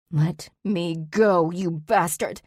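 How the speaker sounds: slow, drawn-out angry yell; angry demand. A narrator's reading. Kicking Zach's shin, cursing him. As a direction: spit the curse at him. Kicking, fighting his hold.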